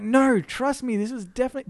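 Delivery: high, loud voice protesting too much; insistent pleading. Acted. Seller convincing doubtful people, insistent.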